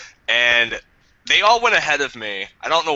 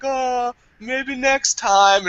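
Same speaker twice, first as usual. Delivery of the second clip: mock flirty lady; 11 semitones higher, much slower than usual. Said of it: "She's like".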